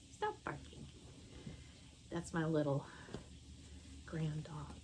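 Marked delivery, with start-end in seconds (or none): 0.1-0.9 high whispered hush; breathy high hush at the dog; hushing a pet. Real, not acted.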